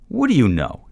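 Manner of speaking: high, loud challenging question; direct challenge. A narrator's reading. Man challenging the stranger, defiant. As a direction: throw it back as a dare. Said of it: "in a direct challenge".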